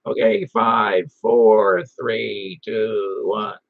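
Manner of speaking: slow, low mock radio countdown; drawn-out countdown, starting strong and easing off; countdown into a mic. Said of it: "hold the mic about ten inches".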